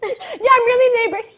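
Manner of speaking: high, loud giddy insistence; hammed-up squeaky delight; big-smile cheer. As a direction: say it grinning, far too happy. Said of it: "with a big smile on your face".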